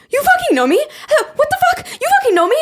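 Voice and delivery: very high-pitched mock shock; imitated stunned disbelief. Acts friend's shock at being recognized.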